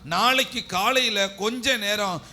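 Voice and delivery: high, loud preacher's surge; impassioned preaching. Real, not acted. Preacher telling why Jesus could not sleep, impassioned preaching.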